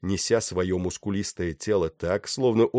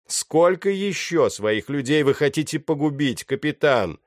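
raised, declaimed reproach; theatrical voice; 7 semitones higher, 5 dB louder than usual. A narrator's reading. Pirate commander to the captain, in a theatrical voice. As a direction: put the question grandly, pitched up for an audience. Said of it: "театральным голосом произнёс он".

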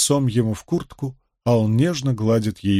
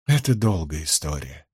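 low, subdued and rueful; sorrowful sigh; 7 semitones lower, 3 dB quieter than usual. A narrator's reading. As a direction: answer with a heavy-hearted sigh in the voice. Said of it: "горестно вздыхая". (горестно, со вздохом)

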